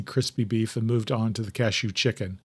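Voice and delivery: usual speaking voice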